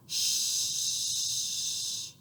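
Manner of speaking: soft unvoiced shh; shower shh. Shown as a demonstration. Imitating the shower for the children. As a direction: hiss like running water.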